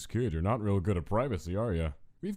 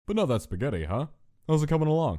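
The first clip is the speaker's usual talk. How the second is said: teasing nudge; 8 semitones higher, 4 dB louder, faster than usual. Acted. Teasing about the spaghetti.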